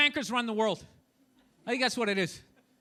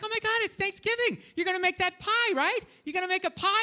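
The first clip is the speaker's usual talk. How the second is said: put-on excited girlfriend; 5 semitones higher than usual. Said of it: "She's like".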